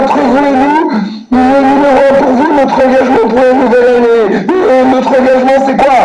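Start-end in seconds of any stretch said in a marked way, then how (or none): none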